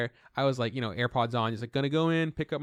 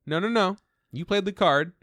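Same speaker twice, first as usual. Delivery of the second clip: louder, higher no no no; insistent; 5 semitones higher, 5 dB louder than usual. Retold or imitated. Friends loudly insisting Dave play his card. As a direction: insist he plays the card he dropped.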